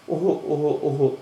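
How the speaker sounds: mock sobbing "oh-oh-oh"; crying. A narrator's reading. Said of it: "گریهٔ او را دید".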